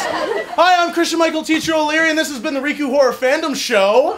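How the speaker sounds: high, energetic host welcome; projected stage greeting. Real, not acted. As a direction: announce yourself brightly to a cheering crowd. Restarts greeting without the silly voice.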